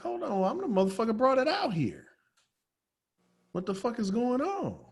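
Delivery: stunned he can't lift it; disbelief. Retold or imitated. To himself, straining at the heavy table, baffled.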